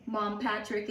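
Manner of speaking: plain reading voice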